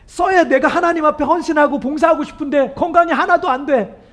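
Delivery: usual speaking voice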